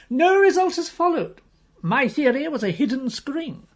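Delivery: Pew's voice. Acted. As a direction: lift the pitch for the excited puzzle lover. Higher voice for the friend Pew.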